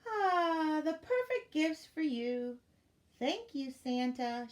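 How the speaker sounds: high, drawn-out happy sigh of thanks; delighted. A narrator's reading. Elf thanking Santa for perfect gifts, delighted.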